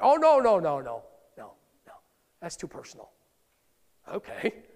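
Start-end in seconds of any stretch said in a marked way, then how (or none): 0.0-3.1 defensive refusal. Retold or imitated. Voicing a defensive churchgoer.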